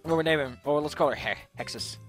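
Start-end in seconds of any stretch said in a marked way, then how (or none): none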